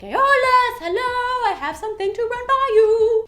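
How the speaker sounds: high, loud singsong hail at a door; singsong calling out. Retold or imitated. Juno knocking on the door of Aeolus, high singsong call. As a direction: sing it out in a high put-on voice.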